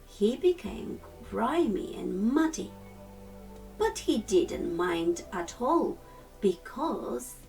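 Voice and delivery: ordinary delivery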